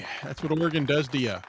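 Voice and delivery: usual speaking voice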